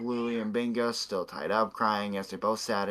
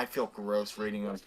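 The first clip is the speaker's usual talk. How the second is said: normal talking voice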